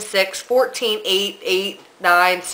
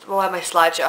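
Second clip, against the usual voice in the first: ordinary talk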